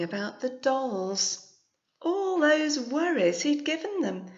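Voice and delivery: teacher reading a tense turn in the story; story narration. A narrator's reading. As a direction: read it as the worry creeps back. Reads picture book aloud.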